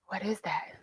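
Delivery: ordinary delivery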